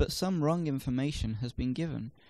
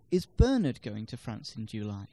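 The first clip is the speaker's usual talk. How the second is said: "Bernard" lifted, the rest unstressed; stress on BERNARD; 3 semitones lower than usual. Shown as a demonstration. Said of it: "can place the stress on different words".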